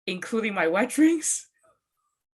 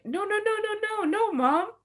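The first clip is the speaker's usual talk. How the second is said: whiny protest; 14 semitones higher than usual. Retold or imitated. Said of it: "And I was like".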